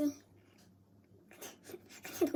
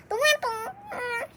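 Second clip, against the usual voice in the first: squealing gush; 7 semitones higher, 3 dB louder than usual. Real, not acted. Squealing over the card.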